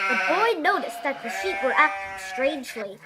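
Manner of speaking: usual speaking voice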